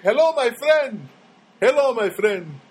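slow, hammed-up Middle Eastern accent. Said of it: "like a guy that would say".

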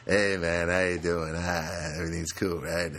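a lightly voiced, slow, laid-back upstairs guy; just a hint of an easygoing dude greeting you; mellow neighbor. Upstairs neighbor greeting him in the hall, lightly voiced mellow dude. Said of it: "he was like".

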